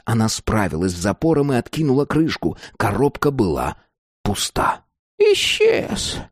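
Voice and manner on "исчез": very high, stunned crone cry; aghast old woman; shocked. A narrator's reading. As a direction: gasp it out in shock, pitched way up. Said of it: "потрясённо проговорила она".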